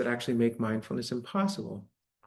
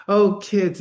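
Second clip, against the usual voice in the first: higher, louder, forced-cheerful greeting; fake sweet; 7 semitones higher, 9 dB louder than usual. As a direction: plaster on a fake smile and sing it out. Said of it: "kind of plaster that smile on".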